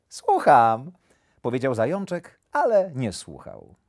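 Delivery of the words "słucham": little hare. A narrator's reading. Hare claims to be listening.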